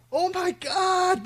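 drawn-out, high mock wail of "Oh my God"; a voice crying out from behind the wall, slow and high; imitated distant cry. Pastor imitates an inmate crying out behind a wall, slow high wail. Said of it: "I heard a".

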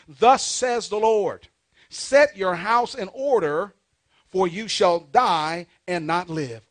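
slow, loud pulpit reading of scripture. Real, not acted. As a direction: proclaim the verse slowly, weighting each phrase. Reads scripture aloud in sermon.

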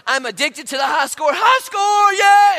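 high, loud triumphant gamer yell. Acted. Pastor playing a gamer who whoops at the high score. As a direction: scream it like a gamer who just beat the record.

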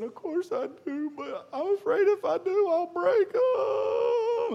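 put-on high, wailing child voice breaking into a cry; crying little boy. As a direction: whimper it like a scared little boy. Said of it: "So he's just crying".

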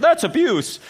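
put-on high, loud shocked teen voice; mock-shocked kid crying abuse. Youth group kid gasps at the pastor, shocked.

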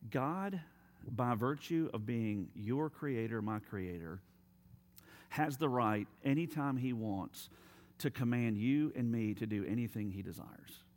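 normal talking voice

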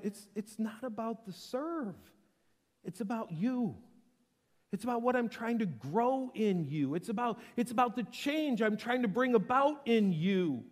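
earnest insistence. Acted. God pressing Elijah, acted.

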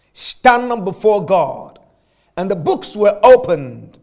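preacher proclaiming judgment from Revelation; scripture reading. Acted. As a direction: read it like thunder from the pulpit. Preacher reads Revelation aloud.

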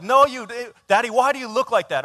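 put-on high, loud, accusing little boy; imitated kid seeing through the excuse; grilling child. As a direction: interrupt yourself to challenge dad. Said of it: "state prosecutor is, like, grilling me".

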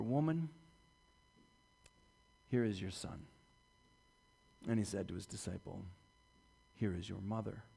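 dying son caring for his mother softly; tender farewell. Acted. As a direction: hand his mother over with love. Jesus giving his mother to John.

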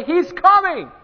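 high, loud herald announcing the arrival; joyful crier raising the alarm; excited calling out. Retold or imitated. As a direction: shout the news to the whole town. Best man announcing Jesus.

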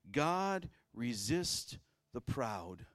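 slow, solemn scripture quote; scripture reading. Acted. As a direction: lay each word down slowly. Quoting scripture.

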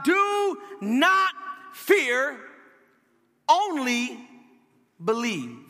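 "Do not fear" high and drawn out, each word set apart; long pauses before "only" and "believe", dropping at the end; emphatic command. A narrator's reading. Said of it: "Jesus says it emphatically".

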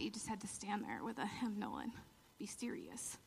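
hushed, stiff church whisper; mock solemn whisper. Mocking serious hymn-singers in church, whispered. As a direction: whisper it like a prim hymnal singer.

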